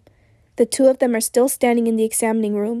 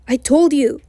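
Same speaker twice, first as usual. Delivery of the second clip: raised, insistent near-shout; 3 semitones higher, 4 dB louder than usual. A narrator's reading. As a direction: shout it back in frustration. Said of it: "he shouts".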